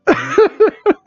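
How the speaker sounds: high shrieking laugh. Real, not acted. Laughing at the song.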